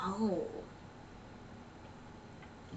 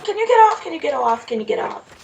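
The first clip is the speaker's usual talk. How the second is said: exasperated; 7 semitones higher, 6 dB louder, faster than usual. Real, not acted. Repeated plea at an NPC blocking the way.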